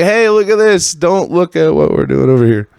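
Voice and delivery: the media steering eyes away from the real story; mock distraction. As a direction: wave the crowd off like a carnival barker. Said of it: "they're just like".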